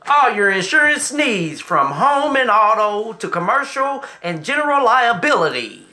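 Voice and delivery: projected announcer delivery; sponsor read. Acted. Host pitching insurance services to viewers, announcer ad read. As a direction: list the coverages like a radio advert.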